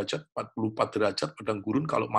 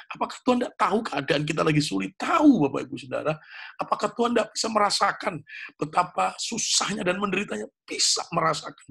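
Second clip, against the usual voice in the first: rising call and answer, each answer higher; ask, then fire back the answer higher each time; preaching at full force; 8 semitones higher, 3 dB louder than usual. Acted. Pastor to the congregation, answering his own questions, building fervour.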